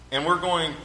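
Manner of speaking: normal talking voice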